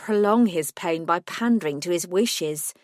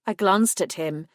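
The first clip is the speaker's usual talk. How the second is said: ordinary delivery, nothing marked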